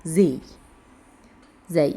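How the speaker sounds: زي said with a plain buzzing z; all pronounced z. Shown as a demonstration. Teacher showing four letters' shared sound, زي with plain z. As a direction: sound زي on a clean z. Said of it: "كلهم بينطقوا".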